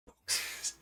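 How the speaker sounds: hushed, voiceless whisper; whispered reaction. Retold or imitated. They watch the movers bring boxes, retold in a whisper. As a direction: whisper the word under your breath.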